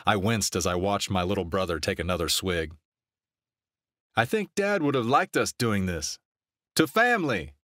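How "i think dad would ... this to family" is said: high, lifted toasting voice; tipsy toast. A narrator's reading. Tipsy brother toasting family and late dad, rousing toast. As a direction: build to a high, ringing final call. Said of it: "he toasted".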